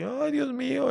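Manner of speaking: very high-pitched hammed-up wail; mock crying. Trapped lion despairs of escape, mock crying. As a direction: wail it like a storyteller's weeping lion. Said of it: "Y empezaba a llorar así".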